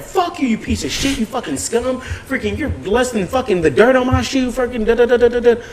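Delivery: high-pitched mock tirade; reliving the rant, pitch way up; imitated furious tirade at a shoplifter; retold cursing out.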